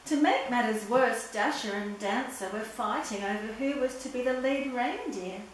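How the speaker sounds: usual speaking voice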